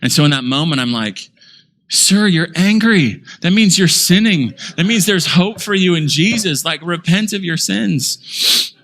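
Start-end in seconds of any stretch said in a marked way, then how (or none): 1.9-8.7 high, slow, mock-sweet scolding; slow high syrupy sarcasm; sarcastic preacher. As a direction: needle him with sugary sarcasm. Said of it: "I get a little sarcastic".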